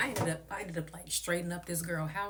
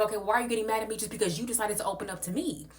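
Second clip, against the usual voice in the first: heated retort; 6 semitones higher, faster than usual. Retold or imitated. Own retort in heated argument.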